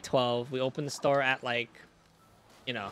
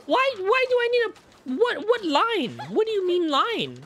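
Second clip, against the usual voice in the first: high, slow outraged customer voice; mock karen voice; 5 dB louder, slower than usual. Coworker imitates a customer demanding help, in a high slow whine. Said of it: "And she goes".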